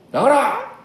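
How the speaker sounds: high, loud exorcism cry; commanding shout. Acted. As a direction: throw the order out hard.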